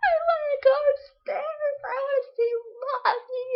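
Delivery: put-on very high, loud toddler wail; hammed-up bawling like a little kid; mock crying tantrum. Child screaming for mommy at bedtime, mock sobbing tantrum.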